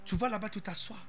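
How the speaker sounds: ordinary delivery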